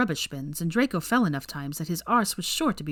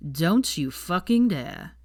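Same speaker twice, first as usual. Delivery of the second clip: slow, threatening warning; menacing; much slower than usual. A narrator's reading. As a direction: growl it as a threat. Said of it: "Zabini narrowed his eyes".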